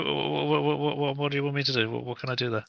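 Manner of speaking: high, stuttering, panicky trooper; frightened stammer. Pretend. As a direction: stammer like a shell-shocked soldier. Twitchy trooper stammers at commissar.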